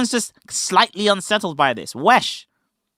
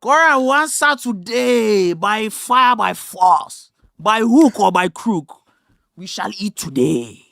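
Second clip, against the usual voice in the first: put-on slow, emphatic Nigerian accent preaching; 3 dB louder, slower than usual. Streamer hamming up the Nigerian accent bit. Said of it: "your Nigerian, uh, accent".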